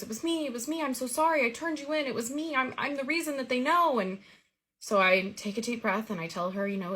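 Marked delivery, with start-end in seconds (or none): 0.0-4.0 high, mock-tearful confession; a hint of crying, voiced high; retold crying. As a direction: imitate a girl sobbing out an apology, pitched up. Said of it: "she starts crying and she goes".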